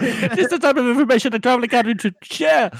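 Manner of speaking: very high, loud mock-hysterical rant. Audiobook Longfoot berating the traveling captain, comically hysterical. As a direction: imitate a frantic shrieky outburst. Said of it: "he gets, like, really hysterical".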